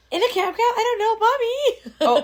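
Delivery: put-on high, slow panicked child voice; little girl voice. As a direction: squeak it out like a lost little kid. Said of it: "She'd be like".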